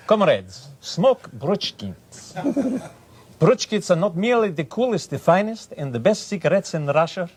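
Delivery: a grand Soviet ad pitch; Russian cigarette ad. Shown as a demonstration. Sellers doing a Russian ad for Bruchkins cigarettes. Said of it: "I did one once about cigarettes".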